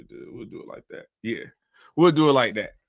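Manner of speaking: normal talking voice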